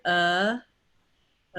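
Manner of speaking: "uh" as in the word the; a relaxed, isolated "uh" vowel; uh sound. Shown as a demonstration. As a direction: hold a neutral "uh" on its own. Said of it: "going to say an uh sound".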